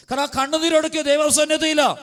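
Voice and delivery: high, impassioned sermon voice; fervent preaching. Acted. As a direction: preach it out with rising fervour. (ഉയർന്ന സ്വരത്തിൽ ആവേശത്തോടെ പ്രസംഗം)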